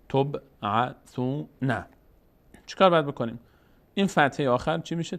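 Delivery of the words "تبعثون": تبعثون sounded out slowly, piece by piece, ending on an open "na"; full final vowel. Shown as a demonstration. Teacher shows the word ending in fatha, full final vowel. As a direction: say تبعثون in slow separate syllables with the last fatha voiced. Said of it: "اگر ما روی این کلمه توقف کنیم که آخرش فتحه داره".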